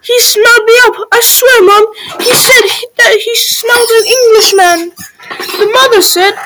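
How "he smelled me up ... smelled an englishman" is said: put-on high, panicky boy; scared child's urgent wail; frightened insistence. A narrator's reading. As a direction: plead to be believed, pitched very high. Frightened Jack insists to his mother.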